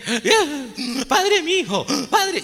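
put-on distressed girl begging the priest, high and tearful; mock sobbing plea. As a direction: imitate a girl choking on tears. Distressed pregnant girl voiced.

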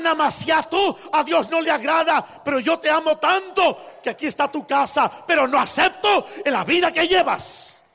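loud, high fervent preaching; preaching at full force. Acted. Preacher exhorting the congregation, in full-force preaching. Preacher stresses love with truth.

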